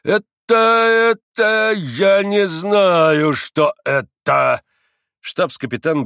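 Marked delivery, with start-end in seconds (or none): 0.0-4.6 much higher, louder, slow flustered doctor; loud bewildered repeating. A narrator's reading. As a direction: sputter it out, beside himself. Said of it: "громко повторяя". (громко, в растерянности)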